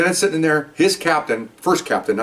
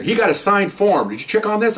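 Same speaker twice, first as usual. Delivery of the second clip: retold confrontation; 7 semitones higher than usual. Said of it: "I'm on him".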